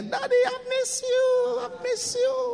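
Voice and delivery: put-on very high, slow childish pleading; little daughter voice. Father imitating his little daughter on the phone, high childlike. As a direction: play the little girl missing her dad. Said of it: "I go".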